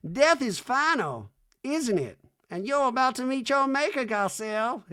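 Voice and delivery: mock [name] as a horror villain; hammed-up sinister high [name]; horror-film [name]. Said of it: "start of a horror film".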